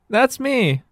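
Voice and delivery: put-on very high child voice; high-pitched young heroine; eager little girl. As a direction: chirp it as a keen young girl. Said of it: "says Nellie".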